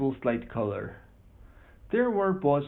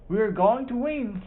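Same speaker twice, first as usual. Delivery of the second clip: high, loud cry of victory; excited shout; 8 semitones higher, 4 dB louder than usual. Acted. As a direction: shout it with glee, sure of the win. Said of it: "Stanley shouted".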